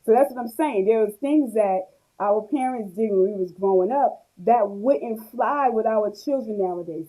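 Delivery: ordinary delivery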